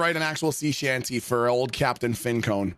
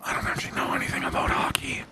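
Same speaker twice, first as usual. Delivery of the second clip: whispered confession, quicker. Real, not acted. Admitting to Chad he knows nothing about hockey, whispered. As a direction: let it slip out in a hushed whisper. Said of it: "confessing in a whisper".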